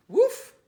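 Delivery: loud high yappy woof; dog bark. Pretend. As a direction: bark like a happy little dog. Reader barks as the dog.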